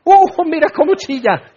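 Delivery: high mocking squeal of delight; a hammed-up cruel cackle of delight; mock glee. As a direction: gloat like a cruel tormentor.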